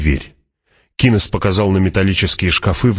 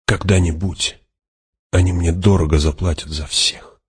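understated, weighty and subdued; heavy-hearted. A narrator's reading. Paul vows payback after learning Duncan is dead, heavily. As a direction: say it heavily, with restraint. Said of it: "тяжело сказал Пауль".

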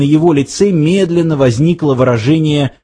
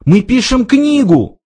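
high, urgent plea; pleading; 9 semitones higher than usual. A narrator's reading. Ford begging to be spared, high and pleading. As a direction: plead the case, pitch leaping up. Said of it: "взмолился Форд".

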